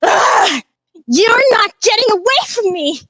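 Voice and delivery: high, loud villain shout; furious shout. Acted. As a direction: scream it at the fleeing prey. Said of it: "she goes".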